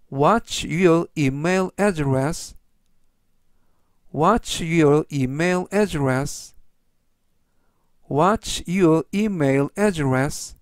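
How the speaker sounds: slow, measured English for learners; teacher's deliberate, word-by-word pace; slow model reading. Shown as a demonstration. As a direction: read it out slowly for repeat-after-me. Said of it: "천천히 세 번씩 읽어드리고요".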